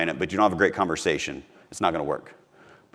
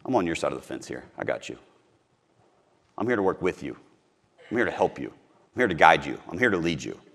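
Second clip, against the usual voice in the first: softer, calm reassurance to a prospect; reassuring agent; 4 semitones lower, 5 dB quieter than usual. Pretend. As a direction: soothe the worried client. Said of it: "what if you just open the gate".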